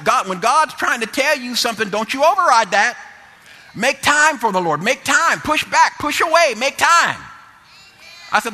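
high, loud, urgent exhortation; loud revival-style urging, pitched high; full-force preaching. Real, not acted. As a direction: drive each phrase home like a fired-up preacher.

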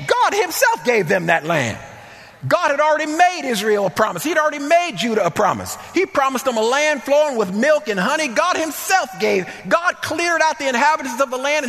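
high-pitched, full-force preacher delivery; impassioned preaching. Acted. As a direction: drive each phrase home from the pulpit, voice lifted high.

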